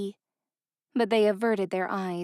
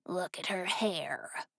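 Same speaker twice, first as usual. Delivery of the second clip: slow breathless whisper; 2 semitones lower, 9 dB quieter, slower than usual. A narrator's reading. Raspy thug whispering to his partner about the girl. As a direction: hush it out, slowly, almost no voice. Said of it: "the raspy voice whispered".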